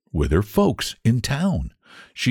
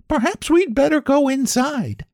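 much higher, timid little cherry voices; whisper-worried tiny fruit fleeing a bird; fretful small cherries; nervous cherries. Acted. Cherries fret about Blue Jay.

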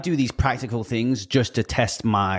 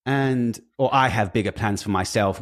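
ordinary talk

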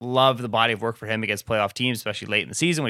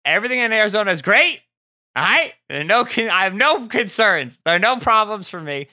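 higher, louder mock cheer; sarcastic; 9 semitones higher, 4 dB louder than usual. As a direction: insist all is fine, clearly not. Ironic denial of Cardinals worries.

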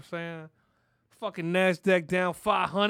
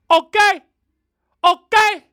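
higher and louder, pressing the point; insistent okay; 8 semitones higher, 13 dB louder, slower than usual. Real, not acted. Host pressing the audience, insistent higher louder okay. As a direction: demand the listener agree. Badgering listener for agreement.

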